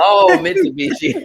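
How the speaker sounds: high, loud "oh" of sudden recognition; light up as it clicks; delighted realization; surprised. Real, not acted.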